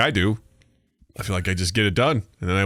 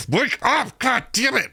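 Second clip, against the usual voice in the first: mock angry wife; 13 semitones higher, slower than usual. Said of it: "she's like".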